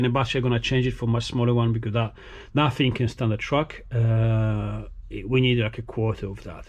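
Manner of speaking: ordinary delivery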